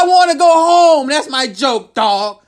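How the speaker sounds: high, pleading whine, slowing at the end; mock whine. Comic retelling his answer to the jail guard, mock pleading whine. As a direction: beg like a homesick kid. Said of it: "I told him".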